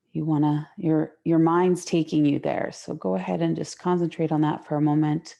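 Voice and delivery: ordinary delivery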